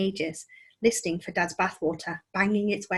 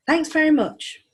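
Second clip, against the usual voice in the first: high, loud sarcastic thanks; 8 semitones higher, 8 dB louder, slower than usual. Acted. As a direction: snap it back sarcastically. Sarcastic reply to no.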